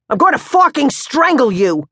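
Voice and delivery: high, loud strangled threat; enraged father. Acted. Pops wrestling his son, enraged. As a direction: roar the threat.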